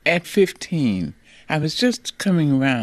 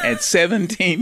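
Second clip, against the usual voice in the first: higher, laughing as you speak; amused laughter; 6 semitones higher than usual. Real, not acted. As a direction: let a real laugh into the words. Amused at the brothers' height contrast.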